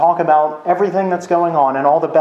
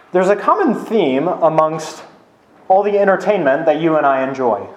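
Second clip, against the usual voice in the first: ordinary delivery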